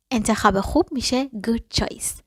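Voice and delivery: whispered teacher note; whispered aside. Shown as a demonstration. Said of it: "teacher gives translation aside".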